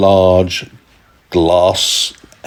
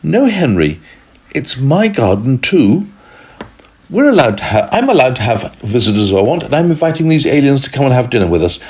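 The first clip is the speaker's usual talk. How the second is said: put-on high, insistent girl's voice; little girl voice; 6 semitones higher, faster than usual. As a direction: stand up to her brother like a little girl. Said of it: "But Henrietta said".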